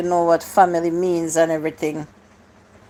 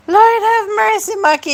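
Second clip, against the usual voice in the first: real giddy glee, pitched way up; 12 semitones higher, 3 dB louder than usual. Real, not acted. Host cracking up at a viewer's joke, squealing like a kid in a candy store. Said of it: "I sound like a kid in a candy store".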